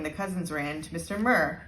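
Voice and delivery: ordinary delivery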